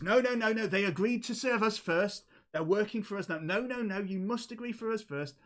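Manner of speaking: high-pitched, insistent bickering; vehement objection. Acted. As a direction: argue over a rival to claim them first. Gnome objects to the rival offer.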